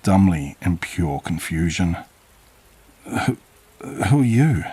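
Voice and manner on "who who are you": frightened stammer, the second word jumping higher; terrified narrator stammers at the strange old man; stuttering in fear. Acted. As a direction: trip over the first word, then blurt it out. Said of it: "I managed to stutter out".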